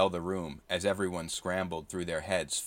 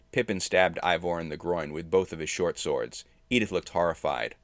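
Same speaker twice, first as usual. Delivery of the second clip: plain reading voice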